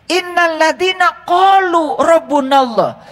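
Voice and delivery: slow, chanted recitation of the verse; Quranic recitation. Acted. Preacher chanting the verse to the congregation. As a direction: intone the Arabic verse slowly.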